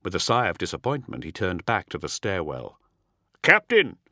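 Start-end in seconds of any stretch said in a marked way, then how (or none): 3.4-4.0 high, loud call from below; urgent shouted alarm. A narrator's reading. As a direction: shout up to your officer. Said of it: "The shout came from below".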